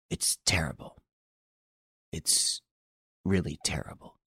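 breathy horrified whisper, barely voiced; husky whisper. A narrator's reading. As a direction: hush it out, shaken by what he saw. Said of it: "said in a husky voice".

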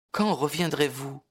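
high shy question; young girl voice. Acted. Lucie asking. (question timide et aiguë)

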